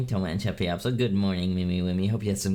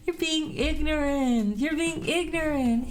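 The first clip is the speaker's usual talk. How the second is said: falsetto scolding, much higher and slower; squeaky mock scold; slower than usual.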